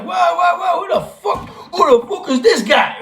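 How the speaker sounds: high startled whoa, pitch jumping up; stunned who is this, half laughing; high incredulous whoa whoa at the stranger; startled disbelief. Retold or imitated. Startled retold reaction.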